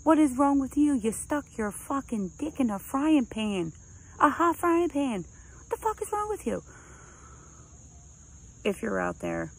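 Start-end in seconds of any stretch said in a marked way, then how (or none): 0.0-6.6 mock outraged screaming, climbing higher and higher; retold inner scream. Screaming in their head at the boyfriend's stupidity, rising mock outrage. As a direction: build to a high, incredulous rant. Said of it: "in my head I'm screaming".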